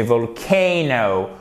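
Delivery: VOLCANO slow, with AY as in oseille; correct long AY. Shown as a demonstration. Teacher corrects the vowel for students, slow English pronunciation. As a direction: stretch vol-KAY-no. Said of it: "comme dans le A de oseille".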